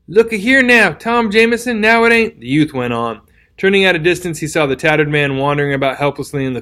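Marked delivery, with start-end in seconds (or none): 0.1-2.4 higher, plaintive calling after him; a lightly voiced, pleading bleat. A narrator's reading. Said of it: "bleating plaintively".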